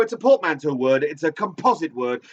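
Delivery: ordinary talk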